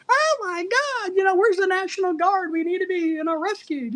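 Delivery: a mock whimpering American in a disaster; mock crybaby. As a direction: mimic a whining, helpless crybaby. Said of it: "we be crying like baby bitches".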